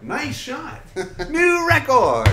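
high, loud chirpy announcer; hammed-up announcer congratulating the player; game announcer voice. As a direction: imitate a cheery game voice. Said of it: "And she's like".

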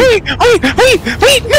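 very high, squealing panic; frantic protest. Real, not acted. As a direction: squeal it out to stop them. Frantic protest at game mistake.

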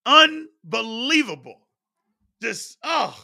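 slow, high and loud; unbelievable, dragged out and pained; drawn-out disbelief. Real, not acted. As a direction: stretch it out in real disgust. Ruined parlay, dragged out disbelief.

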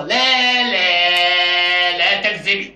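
high, angry calling out for the boss; angry shouting. Acted. Father rages at the staff, shouting for the boss. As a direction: storm in and yell for him.

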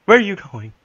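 high, loud panicked call. Real, not acted. Scared player shouting after partner, high and loud. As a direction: cry out after them.

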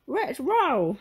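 high, loud dog voice; talking dog's high bark of words; Scooby. Acted. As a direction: agree like a cartoon dog. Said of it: "Scooby agreed".

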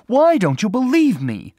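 high, loud, exasperated outburst; indignant shout; angry cry. A narrator's reading. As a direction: demand to be believed, voice soaring. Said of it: "she cried angrily".

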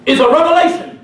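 preacher's voice. Retold or imitated. Preacher booming a revelation to the street gang. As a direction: preach it full force at the gang. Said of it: "I put on my preacher's voice".

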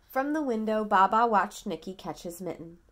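usual speaking voice

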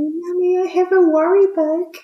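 put-on high, small child voice; imitating a little girl's worry; a daughter telling mom her fear; child's voice. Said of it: "she tells me".

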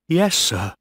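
put-on high, timid boy's reply; afraid. A narrator's reading. Young officer answering a stern captain, afraid.

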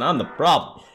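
low, subdued admission; resigned self-blame. Acted. Character blames themselves for everything, resigned. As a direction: drop the pitch and own the blame.

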